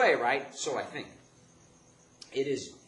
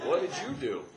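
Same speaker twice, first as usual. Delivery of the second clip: accusing father; 8 semitones higher, 9 dB louder than usual. Retold or imitated. Father voiced demanding explanation.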